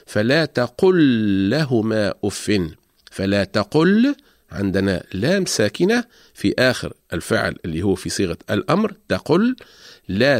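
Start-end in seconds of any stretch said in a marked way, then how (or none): 0.1-4.1 فلا تقل لهما أف recited slowly, the two L sounds merged; slow Quranic recitation. Shown as a demonstration. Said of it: "مثال ذلك قول الله تعالى".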